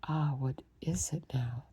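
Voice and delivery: plain reading voice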